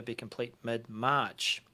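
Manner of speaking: normal talking voice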